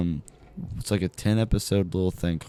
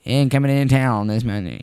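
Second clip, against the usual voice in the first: high, loud vintage announcer; radio announcer; 6 semitones higher, 6 dB louder than usual. Pretend. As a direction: boom it like a fifties radio host. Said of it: "nineteen fifties radio announcer".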